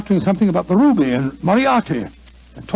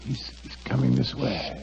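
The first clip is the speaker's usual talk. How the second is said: hushed alarm; 6 semitones lower, 10 dB quieter than usual. Acted. Hiding, man approaching.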